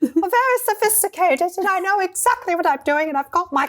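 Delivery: high, put-on telephone voice; hammed-up high-pitched sophistication; mock sophisticated voice. Host mocking her on-camera self, mock sophisticated voice. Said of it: "got my high-pitched telephone voice on".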